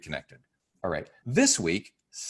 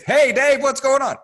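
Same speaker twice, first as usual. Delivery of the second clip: loud, much higher booming call; booming like with Dave; 11 dB louder than usual. Retold or imitated. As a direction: boom it out the way you greet Dave. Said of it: "the way I speak to Dave".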